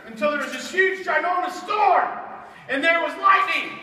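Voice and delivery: rising to a big, loud storm; booming storm narration; dramatic storm telling. A narrator's reading.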